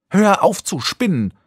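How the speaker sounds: high, drawn-out angry outburst; sudden yell. A narrator's reading. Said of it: "schrie sie auf einmal".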